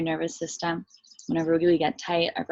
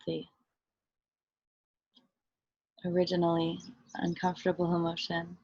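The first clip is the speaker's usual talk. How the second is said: soft, unhurried meditation voice with long pauses; guided meditation; 5 dB quieter than usual. Real, not acted. Teacher leading listeners into body and breath, guided meditation. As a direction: guide them gently inward, softly and evenly.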